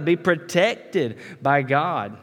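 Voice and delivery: normal talking voice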